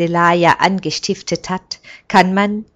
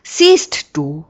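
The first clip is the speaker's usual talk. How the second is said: proud; 6 dB louder than usual. A narrator's reading. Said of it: "sagt Heman stolz".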